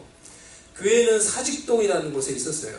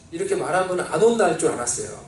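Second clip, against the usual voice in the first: ordinary talk, nothing marked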